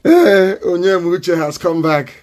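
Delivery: words broken by a laugh. Real, not acted. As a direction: laugh as you welcome him back. Greeting returning listener, laughing.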